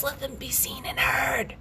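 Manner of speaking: hushed whisper before the release; coaxing whisper to the viewers. Real, not acted. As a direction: breathe the words out unvoiced. Coaching viewers to release emotions.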